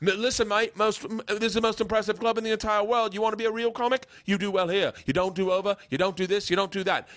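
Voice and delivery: high-pitched mock Australian comic; Australian accent. London comics lecturing the visitor, high mock Australian accent. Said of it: "I think I'm doing Australian".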